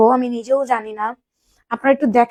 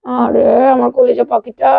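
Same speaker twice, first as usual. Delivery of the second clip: slow, louder cooing endearment; 6 dB louder, slower than usual. Real, not acted. Mother cooing slowly to her crying daughter. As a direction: stretch it out to soothe her.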